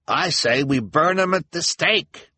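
high, loud, drawn-out call to the mob; rabble-rousing cry. A narrator's reading. Mister Lesko incites townspeople against the children, indignant cry. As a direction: whip up the crowd. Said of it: "cried Mister Lesko".

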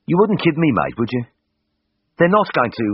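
nervous lad begging for reassurance; anxious appeal. A narrator's reading. Patient pleads with the vet before surgery, anxious Birmingham voice.

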